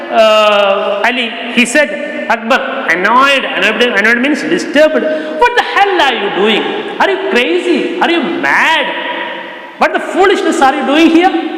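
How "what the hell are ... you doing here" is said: high, fast furious shopkeeper yelling; angry shout. Retold or imitated. Shopkeeper yelling at the boy over the spilled vegetables, angry shout. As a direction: tear into the boy for the mess. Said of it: "He shouted at".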